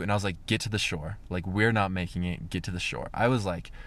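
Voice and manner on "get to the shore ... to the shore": quiet tense urging; low, anxious command to paddle back; worried urging. Retold or imitated.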